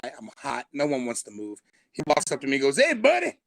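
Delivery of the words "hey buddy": high, loud "Hey, buddy" of a chummy neighbor; neighbor's loud greeting. Retold or imitated. Neighbor Todd greeting the new guy, high loud mock cheer. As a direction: call out high and bright like a fake-friendly neighbor.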